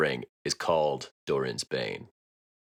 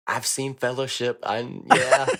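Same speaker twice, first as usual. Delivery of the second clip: hesitant agreement; 7 semitones higher, 4 dB louder, slower than usual. Retold or imitated. Voicing a hesitant viewer's reaction.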